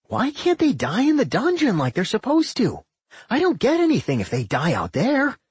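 high, whiny complaint; a petulant little dungeon; childish dungeon voice. A narrator's reading. Doc voiced by narrator.

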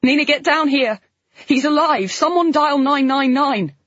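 high, loud and frantic; panicked shout. A narrator's reading. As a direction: yell down the stairs in panic. Panicked call for help.

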